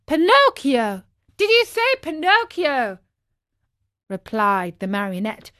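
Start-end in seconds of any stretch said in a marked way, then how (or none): 0.1-3.0 high, astonished outburst; startled excitement. A narrator's reading. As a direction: jump at hearing your own name. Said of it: "jumping to his feet".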